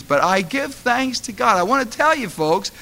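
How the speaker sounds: ordinary delivery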